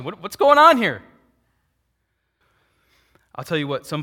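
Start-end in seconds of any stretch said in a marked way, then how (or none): 0.0-1.0 much louder and higher, nearly shouting; exclaimed question. Shown as a demonstration. Preacher driving home the question to the congregation, nearly shouting. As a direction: throw the question out at full voice. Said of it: "That's the question".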